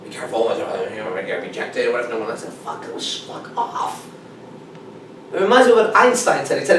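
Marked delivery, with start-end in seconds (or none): 0.0-2.5 nagging inner voice. Retold or imitated. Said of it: "this random machine telling you weird shit". 2.5-4.1 muttered dismissal. Retold or imitated. Dismissing the inner voice.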